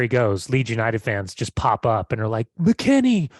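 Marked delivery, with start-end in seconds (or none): 2.6-3.3 high, badly faked British fan; botched British accent. Excited Leeds fan greeting McKennie, poor British accent. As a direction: call it out in a failed British accent. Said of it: "like, they're British, and I know that wasn't British".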